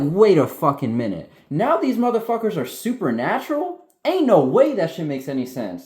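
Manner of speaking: loud, high-pitched indignant rant; excited, near-yelling protest; worked-up outburst. Real, not acted. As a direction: get carried away in disbelief, raising your voice. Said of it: "You're screaming".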